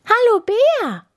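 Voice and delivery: very high, loud little hare voice; delighted hare. A narrator's reading. Hare greets the bear, high and delighted. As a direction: chirp a happy hello as a hare. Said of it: "freute sich der Hase".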